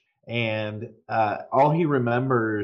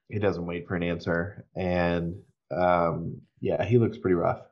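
ordinary talk

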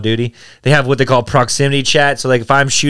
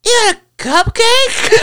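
put-on high, laughing drunk voice; imitating the drunk friend offering cupcakes. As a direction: giggle it like a tipsy friend. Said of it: "hilarious when she's drunk".

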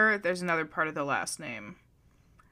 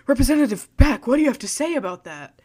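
loud, fast, higher-pitched press question; mock questioner; 6 semitones higher, 9 dB louder, faster than usual. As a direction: fire the question out like a pushy interviewer. Acting out questioning of a representative.

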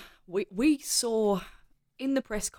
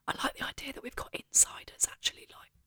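whispering; 2 semitones higher, 7 dB quieter than usual. Real, not acted. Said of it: "just whisper".

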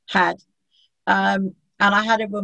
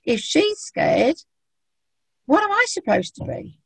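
lightly voiced worried dog, higher; a hint of a fretful dog; voicing the dog; 8 semitones higher than usual. Dog voiced to its scared owner, mildly high and worried. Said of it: "as if saying".

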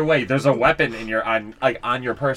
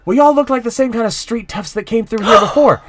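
louder, higher indignant undertaker; annoyed accusation; 9 semitones higher, 10 dB louder than usual. Acted. Undertaker accusing the traveler of being a street tough, indignant. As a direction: accuse them of being ruffians.

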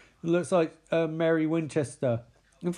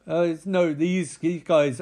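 ordinary delivery

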